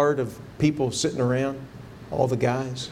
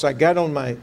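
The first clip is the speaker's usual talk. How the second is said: ordinary talk, nothing marked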